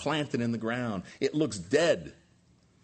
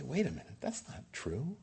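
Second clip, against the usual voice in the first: hushed, much softer aside; quiet objection; 8 dB quieter than usual. Acted. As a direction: voice a listener's doubt under your breath. Voicing a listener's quiet objection.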